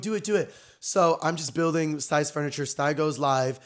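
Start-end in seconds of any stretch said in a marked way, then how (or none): 0.0-0.5 high, quick retold egging-on; excited urging. Egging Sty on to go live, excited. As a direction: lightly act out eager urging.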